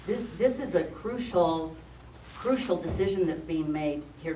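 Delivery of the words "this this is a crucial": usual speaking voice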